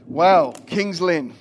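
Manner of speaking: drawn-out excited wow. Real, not acted. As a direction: stretch it out to greet the town with delight. Greeting the congregation enthusiastically.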